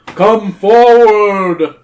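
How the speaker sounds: slow, loud, drawn-out command; booming. A narrator's reading. Cave king orders two humans nearer, slow booming. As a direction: boom each word out long and high. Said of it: "His voice booms through the cavern".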